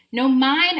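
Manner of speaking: the weed pipes up loudly; whistling weed. Acted. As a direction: call it out bright and loud. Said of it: "a weed whistled".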